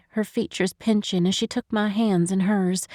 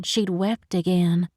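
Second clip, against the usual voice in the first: ordinary delivery, nothing marked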